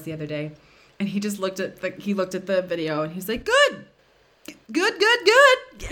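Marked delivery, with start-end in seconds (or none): 3.4-5.9 high and slow, a forced cheery friend; strained fake "good". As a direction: squeeze out a strained, unconvincing good. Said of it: "the intonation and his, like, wide-eyed stare".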